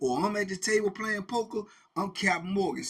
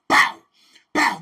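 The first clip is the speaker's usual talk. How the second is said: loud, explosive "bow"; imitated bangs; 7 semitones higher, 14 dB louder than usual. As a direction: bark out sudden bangs. Imitating shots being fired.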